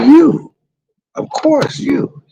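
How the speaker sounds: very high, sing-song fake sweetness; sugary reassurance. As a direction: coo it way up like a sweet liar. Sugary answer to boyfriend.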